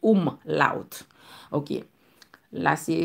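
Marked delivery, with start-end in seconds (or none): 0.0-1.0 omlaut in two slow, separated halves; careful pronunciation. Shown as a demonstration. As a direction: spell it, then sound out omlaut slowly. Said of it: "ou di omlaut". (omlaut an de moso, dousman)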